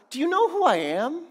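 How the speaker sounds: an angry governor asserting rank; indignant Pilate. Acted. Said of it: "what does he ask".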